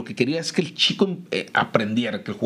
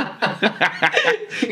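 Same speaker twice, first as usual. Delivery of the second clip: high rising laugh; burst of laughter; 8 semitones higher than usual. Real, not acted. Laughing at the memory.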